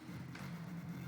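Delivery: long breathy sigh. Real, not acted. Player about to apologise to viewers, sighing. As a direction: let out an unvoiced sigh. Sighs before apologising for grinding.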